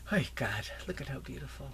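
hushed, whispering in wonder; awed whisper. Real, not acted. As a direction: breathe it out in awe. Awed by delicate flowers.

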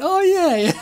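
high-pitched laughing exclamation; listeners' realisation. Acted. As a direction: imitate a sudden realisation through laughter. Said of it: "people listening to this going".

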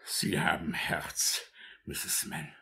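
slow, soft whispering; whispered thanks. Acted. Beadle whispering thanks to the matron, moved. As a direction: breathe the compliment.

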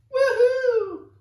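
a state whooping at the plan; cheering. Acted. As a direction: cheer with wild joy.

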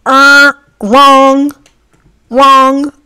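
loud, high, indignant "wrong"; mock outrage. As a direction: scoff it down like a game-show buzzer. Mock indignant reply to comment.

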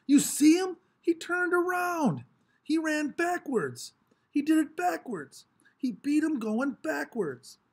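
can't believe what they just saw; amazed crowd. A narrator's reading. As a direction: kids in the crowd gasp at the backwards win, high and amazed. Amazed crowd kids shout.

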